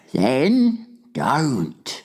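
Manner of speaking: high, curt giant voice; sharp retort. A narrator's reading. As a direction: answer sharply, cutting off argument. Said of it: "answered sharply".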